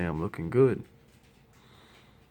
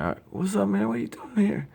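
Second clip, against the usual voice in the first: high, surprised just-woken voice; startled out of sleep, pitched way up; imitating himself roused from bed; groggy surprise. Himself woken up, acted quote.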